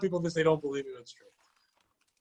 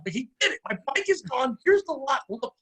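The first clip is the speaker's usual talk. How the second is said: higher-pitched, worked-up pleading of a case; mock outrage, pitched up; retold upset insistence; 6 semitones higher than usual. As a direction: insist indignantly, re-enacting the argument. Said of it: "I'm getting all upset".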